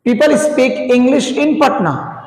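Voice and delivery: each word drawn out slowly for writing down; slow dictation. Shown as a demonstration. Teacher to students, dictating slowly. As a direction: spell the sentence out at writing speed.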